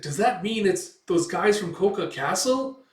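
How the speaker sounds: acted elf in a fluster; alarmed realization. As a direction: ask it with dawning alarm.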